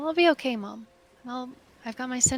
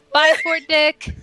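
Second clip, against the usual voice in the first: loud, drawn-out goodbye, pitched up; calling farewell; 5 semitones higher, 9 dB louder, slower than usual. Acted. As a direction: call a slow goodbye back to shore. Waving farewell from the stern.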